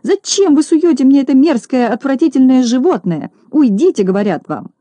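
high, indignant squeal; acted squealing outrage, dropping at the end. A narrator's reading. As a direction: scold in high-pitched disgust. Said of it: "завизжала Минога".

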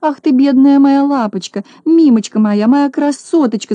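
high, gushing pet-talk lament; high-pitched doting lamentation. A narrator's reading. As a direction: coo and wail over a poor darling. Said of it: "запричитала хозяйка".

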